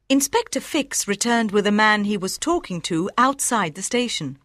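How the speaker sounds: plain reading voice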